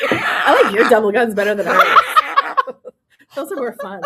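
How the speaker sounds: laughing through the words. Real, not acted. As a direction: giggle while saying it. Laughing at double guns.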